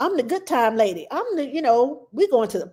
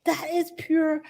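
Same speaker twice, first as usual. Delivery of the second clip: passionate rant; 8 semitones higher than usual. Retold or imitated. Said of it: "I know I'm screaming".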